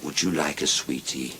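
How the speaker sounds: low, soft coaxing offer; hushed, low-pitched invitation; joke character offering sweets. Acted.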